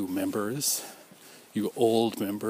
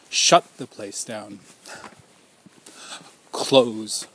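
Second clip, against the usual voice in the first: louder, slower, firm; forceful demand; 5 dB louder, slower than usual. Retold or imitated. Survivor demanding the co-op shut down, forceful. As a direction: proclaim it like a demand. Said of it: "I'm saying".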